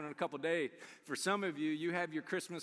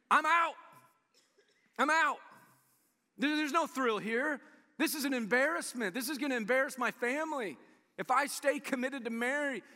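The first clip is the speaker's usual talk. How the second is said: Joseph protesting; 7 semitones higher, 4 dB louder than usual. Retold or imitated. Joseph quits in retold scene.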